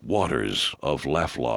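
low, slow, reverent ad voice; deep hushed pitchman voice; deep mystical announcer. Acted. Ad voice again.

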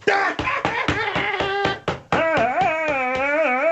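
high, drawn-out mocking laugh. Man jeering at Margaret's put-down, mocking laugh. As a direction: laugh in mockery at her. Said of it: "You were mocking Margaret".

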